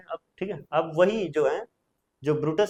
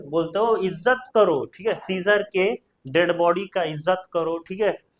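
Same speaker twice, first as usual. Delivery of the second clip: usual speaking voice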